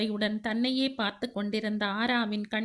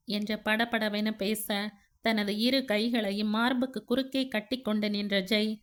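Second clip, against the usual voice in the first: ordinary delivery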